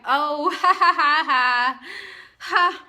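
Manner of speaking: loud forced ha ha ha; hammed-up loud laughter; mock laugh. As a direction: laugh it out big and fake. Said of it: "And she's like".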